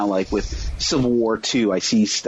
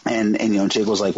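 ordinary talk, nothing marked

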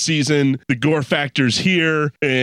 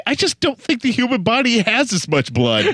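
laughing; 8 semitones higher than usual. Retold or imitated. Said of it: "hoping one of the characters would at least say".

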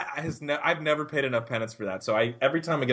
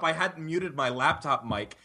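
ordinary talk, nothing marked

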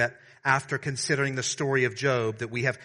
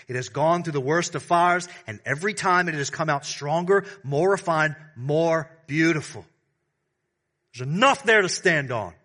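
higher, louder fervent build; rising preaching; 5 semitones higher, 6 dB louder than usual. Acted. Preacher proclaiming the gospel of gold stands firm. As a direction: lift it with conviction.

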